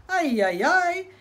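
loud rising yelps of pain; scalded coyote crying out, loud and high. Acted. Coyote scalded in hot water.

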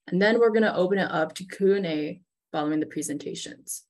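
ordinary talk, nothing marked

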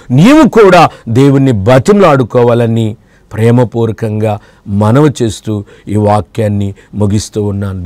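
ordinary delivery

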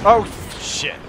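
high loud yelp, then laughing; shocked cry breaking into laughter; startled shout into laughter. Real, not acted. Sudden explosion in game.

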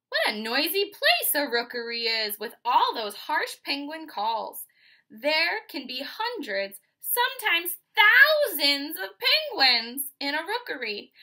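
building up to a loud, excited read-aloud; loud, lively storytelling for kids; noisy and loud. Acted. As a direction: belt it out like a noisy colony, peaking high on thousands. Reading about noisy penguin rookery.

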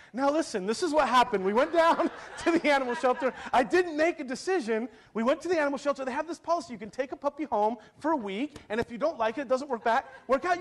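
high-pitched mock explaining voice; imitating defensive wife. Wife to husband after bringing a puppy home, imitated defensive explanation. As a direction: plead the case up high as a wife justifying herself. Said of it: "She's like".

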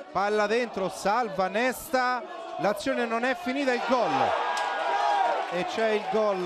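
loud high shouted goal call; breathless italian goal commentary. Real, not acted. (telecronaca del gol urlata e senza fiato)

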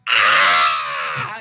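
low, loud shout of alarm; startled scream. Retold or imitated. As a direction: yell in fright at a monster. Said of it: "rather than being like".

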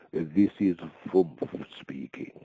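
put-on slow, lightly done music hall German accent; a hint of a comic German spy accent. Retelling a wartime radio spy, slow mock German accent. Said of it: "in a music hall German accent".

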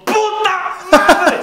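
high, drawn-out yell laughing; mental scream. Pretend. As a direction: scream it inside, hammed up with laughter. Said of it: "en tu mente gritas". (grito interior exagerado, agudo y alargado)

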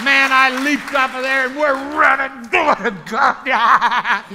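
loud, high shouted testimony; exultant shouting. Retold or imitated. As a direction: yell it as if running in jubilation. Re-enacting the excited run.

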